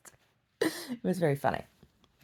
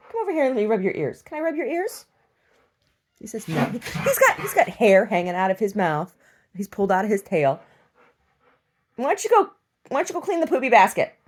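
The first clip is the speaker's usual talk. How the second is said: lightly raised, coaxing tone; coaxing pet talk; 6 semitones higher, 5 dB louder than usual. Real, not acted. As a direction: coax the dog over. Coaxing the dog.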